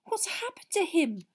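very high, voiced mock whisper of a girl; Hermione's whisper. A narrator's reading. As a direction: ask it high and urgently, as a stage whisper. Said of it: "Hermione whispered".

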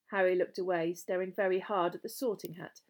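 plain reading voice

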